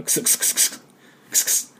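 quick whispered k-s-k-s like stifled giggles; voiceless X sound. Shown as a demonstration. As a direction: repeat k-s on breath alone. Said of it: "これがXの音です". (くすくす笑いのような息だけのXの音)

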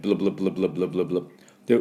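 "ブルブル" as rapid repeated English bl-bl-bl; fast chain of bl syllables; bl sound drill. Shown as a demonstration. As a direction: drill bl over and over, quickly. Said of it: "ビーエルで".

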